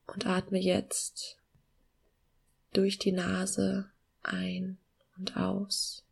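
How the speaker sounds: slow, soft meditation voice with long pauses; calm guiding voice, gently slowing down; guided meditation. Acted.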